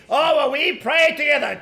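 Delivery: mock pious excuse. Preacher mimics couples who pray at home, mocking.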